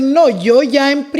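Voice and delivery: firm retort. Retold or imitated. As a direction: push back hard on the critics.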